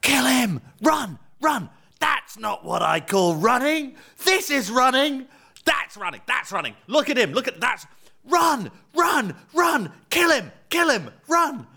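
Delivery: high-pitched mock yell; strained, high touchline shouting, hammed up; imitated coach yelling. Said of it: "yelling really loudly".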